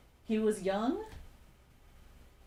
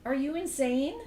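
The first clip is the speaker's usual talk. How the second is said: loud, higher-pitched disbelief; incredulous outburst; 6 semitones higher, 5 dB louder than usual. Real, not acted. Teller reacting to the stalker's envelope stunt, incredulous. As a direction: demand it in rising, raised-voice disbelief.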